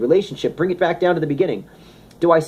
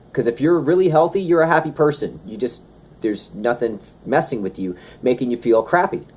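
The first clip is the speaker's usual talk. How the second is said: normal talking voice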